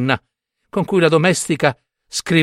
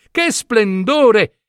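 very slow, higher and stretched; Che splendore stretched out, high and lingering; drawn-out admiration; 7 semitones higher, much slower than usual. Acted. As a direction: savour every syllable of splendore.

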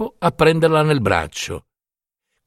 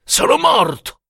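grido disperato; 13 semitones higher than usual. A narrator's reading. Turiddu ferito e accecato grida.

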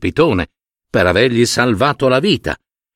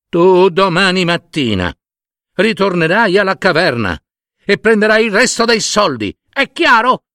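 high, stern commanding wife; bossy wife; 7 semitones higher than usual. Acted. Wife gives husband tomorrow's orders, high insistent scolding. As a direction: lay down orders high, rising to the demand.

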